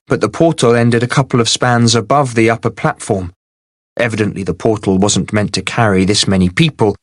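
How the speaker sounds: plain reading voice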